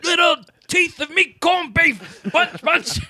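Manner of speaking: high, slow mock yell; hammed-up drunk brawler. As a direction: ham up a pub fighter, laughing. Mocking drunk Irish brawler.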